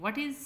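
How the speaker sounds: high, loud "what"; stress on WHAT. Shown as a demonstration. Teacher showing where the voice goes up, stressing "what". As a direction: punch "what" upward. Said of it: "what पे हमारी आवाज़ जो है वो ऊपर होगी".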